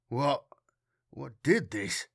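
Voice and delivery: halting stammer with a pause after the first word. A narrator's reading. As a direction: falter on the question, as if stunned. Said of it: "Matthias stammered".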